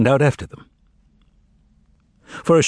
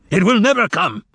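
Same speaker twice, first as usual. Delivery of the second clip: much higher, louder roar; terrible shout; 11 semitones higher, 4 dB louder than usual. A narrator's reading. Pilate roars at Yeshua about the kingdom of truth, terrible shout. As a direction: bellow it to make him recoil. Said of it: "Pilate shouted in such a terrible voice".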